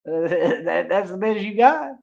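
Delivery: high, amused mocking taunt; laughing taunt. Real, not acted. Laughing repeat of earlier taunt.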